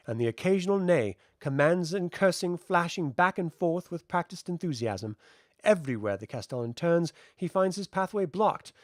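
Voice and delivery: ordinary delivery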